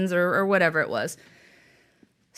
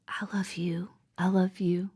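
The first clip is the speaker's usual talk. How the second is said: hushed whisper, much softer; 3 semitones lower, 6 dB quieter than usual. Retold or imitated. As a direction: whisper it like a child to a screen. Daughter's words to the screen.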